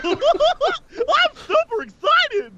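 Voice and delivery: very high, slow and giddy; squeaky excited voice. Pretend. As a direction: squeal it out, laughing. Laughing in costume.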